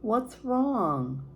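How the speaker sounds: put-on high, tearful child plea; a little girl crying out to her mother; between tears. A narrator's reading. Child pleading with mother through tears, high. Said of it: "I said between tears".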